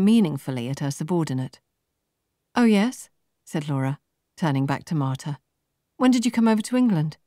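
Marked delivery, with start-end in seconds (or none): 2.5-3.1 higher, bouncy professional cheer; a light voicing of an upbeat recruiter; perky young businesswoman. A narrator's reading. Laura telling Marta her scores, perky and high. 6.0-7.2 higher, bouncy professional cheer; a light voicing of an upbeat recruiter; perky young businesswoman. A narrator's reading. Laura telling Marta her scores, perky and high.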